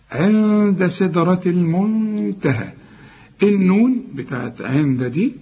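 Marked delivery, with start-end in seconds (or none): 0.1-2.7 عند and المنتهى with n held as a long hum; slow recitation humming the n in عند; hidden nasalised noon. Shown as a demonstration. Teacher models the verse, عند with held nasal hum. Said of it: "اخفيها وغنها".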